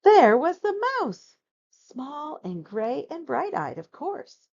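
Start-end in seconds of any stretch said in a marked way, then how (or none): 0.0-1.4 surprised reveal. A narrator's reading.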